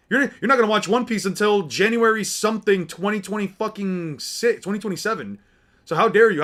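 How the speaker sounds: loud, high fake fury at a casual; hammed-up fanboy rant, shouting; mock outrage. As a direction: ham up the angry anime fan. Mock fan rage at friend.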